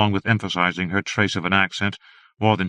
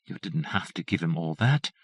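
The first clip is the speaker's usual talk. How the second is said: soft low murmur, much quieter; 3 semitones lower, 7 dB quieter than usual. A narrator's reading. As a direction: murmur it close, almost to herself. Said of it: "Janet murmured".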